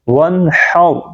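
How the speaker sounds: "ون ہاؤ" with tight throaty h, heavy r; correct haa. Shown as a demonstration. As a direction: push the h of "ون ہاؤ" from mid-throat. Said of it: "اس کو ہم پڑھیں گے".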